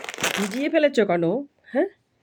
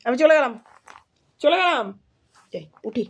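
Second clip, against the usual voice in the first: mock leaving; 3 semitones higher than usual. Pretends to leave to coax daughter.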